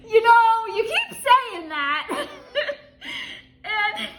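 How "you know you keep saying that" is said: exasperated quote. Retold or imitated. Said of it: "And she goes".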